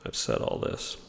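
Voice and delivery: breathy under-the-breath whisper to himself; whispered aside. Real, not acted. Lecturer mutters to himself between points, whispering. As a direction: drop to a whisper, just for yourself.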